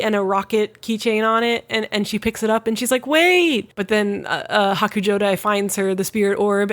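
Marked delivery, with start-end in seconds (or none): 3.1-3.7 high, drawn-out cry; mock calling out. Retelling her calling after the fleeing boy, mock calling out. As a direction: call after someone in a high mock wail.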